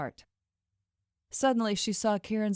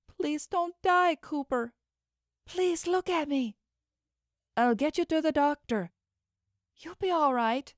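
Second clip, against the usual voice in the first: put-on desperate young woman pleading; 9 semitones higher than usual. A narrator's reading. Dina begs her shot friend to stay alive, pleading high. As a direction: beg him to stay alive, voice pitched high.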